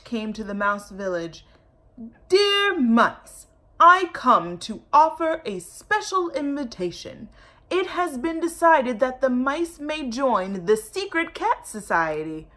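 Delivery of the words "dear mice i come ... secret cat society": sweetly scheming cat, loud and high; sly cat. Acted. Cat inviting the mice, sweet and sly. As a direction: play the cat luring the mice. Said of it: "said the cat".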